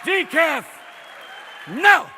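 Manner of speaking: Decaf. shouted high and loud in disgust; outraged repeat. Acted. Robin rejecting decaf, spitting out Decaf. in a loud outraged repeat. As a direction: spit out Decaf. like an insult.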